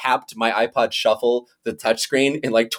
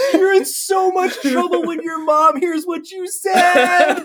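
much higher, slow, gloating put-on dad; mock dad voice; 4 dB louder, much slower than usual. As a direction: stretch every word like a smug parent. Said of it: "He's like".

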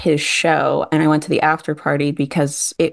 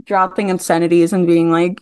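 normal talking voice

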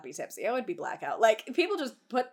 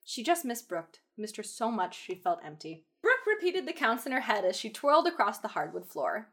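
story narration. A narrator's reading. Reading fanfic narration.

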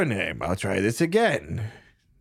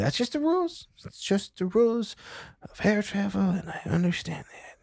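raised pitch; 11 semitones higher than usual. Acted. Character repeats the rules, raised.

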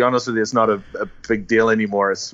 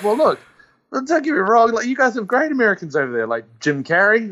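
high-pitched retold appeasement; mock placating; 8 semitones higher than usual. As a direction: pretend to smooth things over. Retelling his attempt to placate caller.

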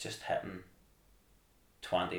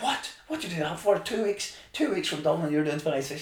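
much higher, louder what, scolding; wife's shock; 9 semitones higher, 5 dB louder than usual. Retold or imitated. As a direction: voice Emer's shocked telling-off. Wife scolding over extra miles.

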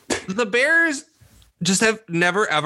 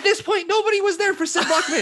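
laughing; 11 semitones higher than usual. Real, not acted. Joking that nobody saw Luckman.

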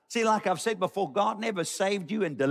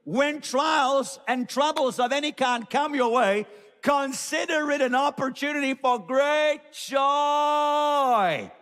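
shouting; 7 semitones higher than usual. Real, not acted. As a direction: shout it so the back row hears. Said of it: "I'm shouting so the people at home can hear me".

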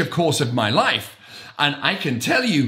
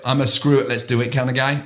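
ordinary talk

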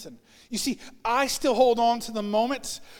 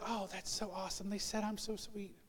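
much softer dreamy murmur; pleased self-talk; 15 dB quieter than usual. Retold or imitated. Person hearing a compliment, softly pleased. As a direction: hush it like a flattered aside.

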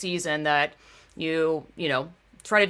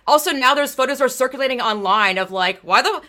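ordinary talk, nothing marked

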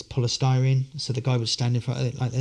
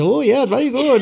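volunteer impressed; 12 semitones higher, 6 dB louder than usual. Retold or imitated. Voicing the blindfolded volunteer's reaction.

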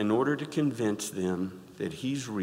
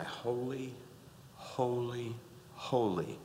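much softer, reverent; softly chanted holy; hushed holy; 8 dB quieter, slower than usual. Acted. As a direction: breathe the holy in awe. Voices angels' hushed cry.

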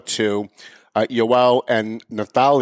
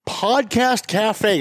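host intro; 9 semitones higher than usual. Acted. Show opening.

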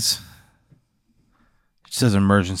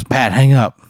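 urgent, hammed-up panicked plea; mock frantic plea; 3 semitones higher, 4 dB louder than usual. Driver begging his friend to hang up, mock frantic.

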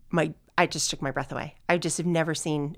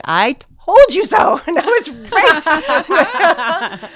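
much higher, much louder, slow gloating cry; triumphant mom; 10 semitones higher, 10 dB louder, much slower than usual. Retold or imitated. Mom elated over the engagement, loud triumphant crow. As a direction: crow it out like a proud mother, laughing.

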